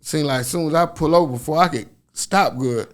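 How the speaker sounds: normal talking voice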